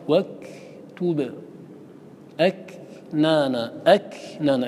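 slow أكنانا with a pause and breath after ك; breathy kaf demo. Shown as a demonstration. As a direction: stop on the ك of واكتب, releasing a puff of air. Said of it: "الكاف كذلك".

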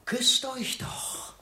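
slow, whispered taunt; slow mocking whisper; sneering whisper. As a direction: hiss the dare softly. Mocking the rivals.

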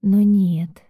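usual speaking voice

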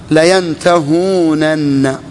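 layantahūnanna drawn out syllable by syllable; full underlying form. Shown as a demonstration. Teacher gives students the verb's origin, full form sounded slowly. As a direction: stretch the long ū and sound both nuns, very slowly. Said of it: "ما هو أصل الفعل؟".

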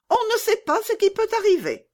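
very high little bandit voice; brash robber girl. Acted. Robber girl answering Gerda about the knife, very high and brash. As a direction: squeak it out like a tough child.

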